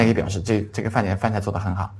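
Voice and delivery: normal talking voice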